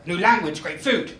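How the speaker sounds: normal talking voice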